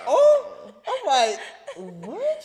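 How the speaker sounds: high, loud "oh"; mock shock in a jumped-up pitch; hammed-up startle; retold startled reaction. Retelling startled reaction to mom.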